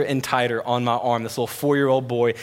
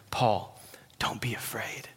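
soft, unvoiced reassurance; hushed whisper; 3 semitones lower, 10 dB quieter, slower than usual. God whispering comfort to scared Paul, whispered. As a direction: whisper it gently to a frightened friend.

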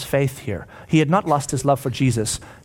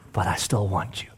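low, soft murmur; gentle reassurance; 7 semitones lower, 8 dB quieter than usual. Acted. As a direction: quietly comfort someone who failed. Jesus reassures Peter.

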